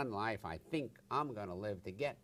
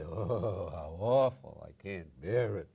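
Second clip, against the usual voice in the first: loud, low, moaning lament; louder, lower voice of someone catastrophizing; mock despair; 3 semitones lower, 7 dB louder, slower than usual. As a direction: ham up a self-pitying wail. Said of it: "while you say".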